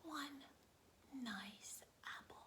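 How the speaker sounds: slow hushed whisper; soft slow storybook whisper. Acted. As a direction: reveal the apple like a treasure. Whispered reveal of the apple.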